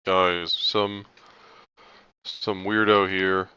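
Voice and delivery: loud guard calling for backup. Acted. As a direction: shout for backup to other guards. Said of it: "He's going to call out".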